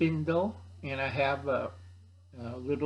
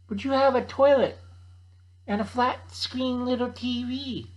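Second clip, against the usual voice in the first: put-on high, louder kid whine; whiny kid; 8 semitones higher, 3 dB louder than usual. Kid complains to Uncle Al about the smelly tent, loud high whine.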